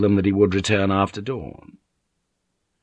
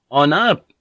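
raised voice calling down the corridor; 7 semitones higher, 8 dB louder than usual. A narrator's reading. Blackthorne calling for someone in the house, loud. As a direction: call out to the empty house. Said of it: "he called out".

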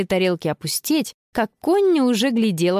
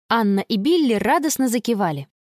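usual speaking voice

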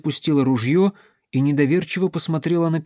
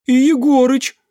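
high, loud frightened cry; frightened young voice; 12 semitones higher, 7 dB louder than usual. A narrator's reading. Youngster in the crowd asks after Yegorych, frightened. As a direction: call out high in alarm, like a scared youngster. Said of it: "молодой напуганный голос".

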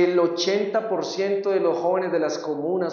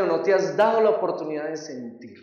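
normal talking voice

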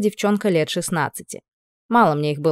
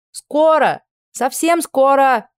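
higher, drawn-out flustered call back; flustered reply; 8 semitones higher, 5 dB louder, much slower than usual. Acted. Heroine stalling the girl at the door, flustered and slow. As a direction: stall her, bewildered and slow. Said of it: "замороченным голосом отозвалась я".

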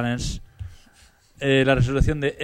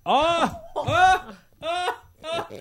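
mock screams; much slower than usual. Mock screaming after blind joke.

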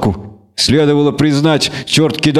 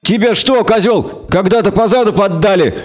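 higher, lightly voiced snapping bard; exasperated bard; 8 semitones higher than usual. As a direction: blurt it out, fed up. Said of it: "не выдержал Лютик".

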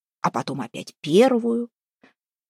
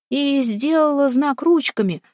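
plain reading voice

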